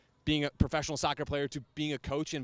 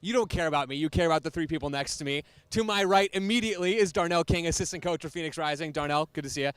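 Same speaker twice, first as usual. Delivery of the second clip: punchy, projected welcome to the crowd; live show host intro; 5 dB louder than usual. Real, not acted. Host introducing coach and players to a live audience, projected announcer delivery. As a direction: open the show big, stressing key words.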